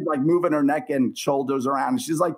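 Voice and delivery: ordinary talk, nothing marked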